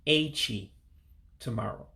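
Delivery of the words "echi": slow careful Echi, falling at the end; Igbo word Echi. Shown as a demonstration. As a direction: say Echi clearly, as a model for learners.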